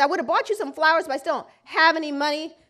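rushed apology. Retold or imitated.

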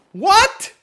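high, loud, incredulous what; baffled reaction. Retold or imitated. Reacting to a Vietnamese name, mock bafflement. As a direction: squawk it in mock disbelief, pitch shooting up. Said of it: "And you're like".

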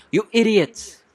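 high, loud scolding; exasperated outburst. Acted. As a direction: berate your bungling assistants. Lawyer scolds his assistants.

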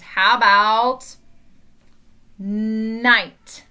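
"night" drawn out with a clear n; slow exaggerated speech modeling. Shown as a demonstration. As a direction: say "How about" slowly, then a long "night". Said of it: "Let's practice with some words".

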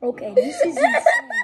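high laughing disbelief. Real, not acted. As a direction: laugh it out, half-shocked. Laughing at gross drink.

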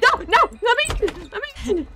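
very high, frantic pleading; panicked squeal. Real, not acted. Player trying to escape the monster, panicked high pleading.

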